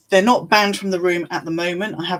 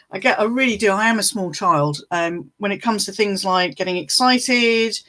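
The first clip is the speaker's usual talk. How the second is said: ordinary delivery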